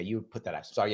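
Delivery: ordinary talk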